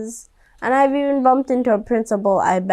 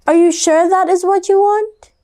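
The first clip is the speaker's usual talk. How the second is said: doubtful mom; 7 semitones higher, 3 dB louder than usual. Acted. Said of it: "Her mom said".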